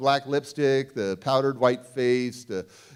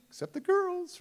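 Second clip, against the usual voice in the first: put-on much higher playful "except the girls"; cheeky high aside. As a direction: tease it with a squeaky grin. Joking aside about girls.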